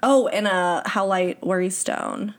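normal talking voice

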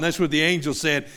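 ordinary talk, nothing marked

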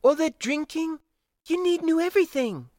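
high-pitched female officer telling him off; scolding. A narrator's reading. Officer on the old man's drinking, scolding. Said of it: "she scolded".

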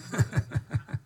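breathy chuckle; quick amused chuckle; light laugh. Real, not acted. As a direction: let a soft laugh slip out. Laughs at own story.

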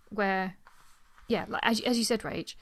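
ordinary delivery

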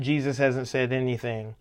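ordinary talk

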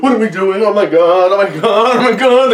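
high frantic mock panic; panicked imitation. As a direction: ham up a nervous wreck repeating oh my God. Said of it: "He's like, like, every second".

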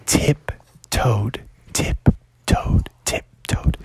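slow, hushed, sneaky narration; stealthy storytelling hush; tiptoeing whisper. A narrator's reading. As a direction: whisper each word like a careful step. Narrator mimics sneaking past dragon.

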